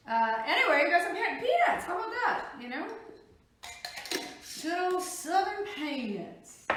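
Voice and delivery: high, loud, laughing fake Southern twang; mock Southern accent. Trying the Southern voice again on the peanuts. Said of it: "Southern accent".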